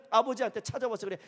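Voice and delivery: usual speaking voice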